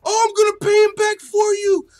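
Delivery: very high, loud savoring of the line; hyped repeat. Real, not acted. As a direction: relish the line, shouting.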